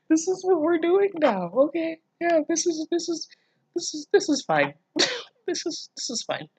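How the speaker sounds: half-laughing, trying to accept it; exasperated disbelief. Real, not acted. Reacting to shocking fanfic line.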